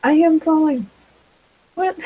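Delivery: startled. Retold or imitated. Retelling the moment she realizes she's falling, startled. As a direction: jolt awake as you realize it. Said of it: "And then I'm like".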